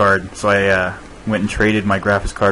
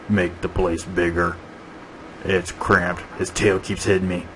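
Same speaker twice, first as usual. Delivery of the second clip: softer, grumbling dinosaur voice, lightly put on; Big Mike; 3 semitones lower, 7 dB quieter than usual. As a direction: grumble like a cramped big beast.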